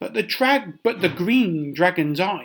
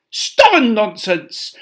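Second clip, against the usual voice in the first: loud angry snap; snapping; 6 semitones higher, 10 dB louder than usual. Acted. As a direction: snap it loudly, annoyed. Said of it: "the Emperor snapped".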